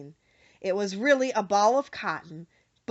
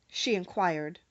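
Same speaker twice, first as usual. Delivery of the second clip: ordinary delivery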